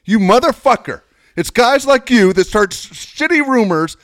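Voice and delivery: furious high shouted tirade, imitating; retold screaming. Football star cussing out the teller over the phone, imitated furious yelling. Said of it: "Screaming at me".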